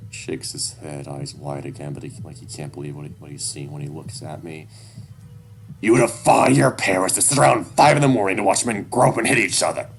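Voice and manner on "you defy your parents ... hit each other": loud, disgusted father; heated parental tirade; angry berating. A narrator's reading. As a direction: lay into your child, loudly. Father berating his child.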